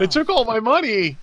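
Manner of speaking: high-pitched plaintive wail; pitiful high creature complaint; wailing bug alien. Acted. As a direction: lament the loss in a high voice. Alien lamenting being robbed.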